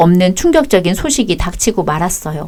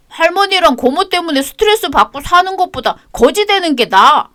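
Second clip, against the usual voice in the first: high, sulky schoolboy answering back; child's defiant voice; 10 semitones higher than usual. Acted. Grandson snapping at his grandmother, high child voice. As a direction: talk back like a stubborn kid.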